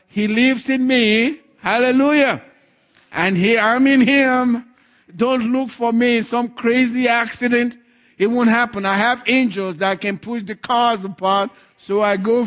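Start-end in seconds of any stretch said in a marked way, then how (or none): 0.1-11.0 fervent preaching. Acted. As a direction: declare it with conviction. Preacher rises to full force.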